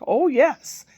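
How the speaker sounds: high, loud eager oh yes; a little boy's delighted yes; eager child. Retold or imitated. Imitating grandson offered pie, eager child voice.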